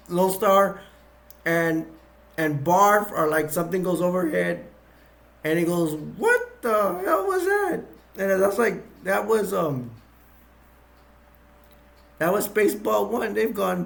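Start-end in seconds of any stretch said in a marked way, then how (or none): 5.9-7.8 mock startled shout. Lone Star startled overhead.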